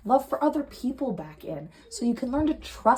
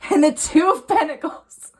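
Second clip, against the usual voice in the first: amused card reveal; 4 semitones higher, 7 dB louder than usual. Real, not acted. Funny card draw.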